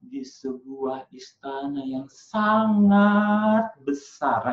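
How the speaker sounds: slow storyteller build peaking on a drawn-out "sangat"; intonation on SANGAT. Shown as a demonstration. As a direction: swell hugely on "sangat", drop for "besar". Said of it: "itu kan intonasi".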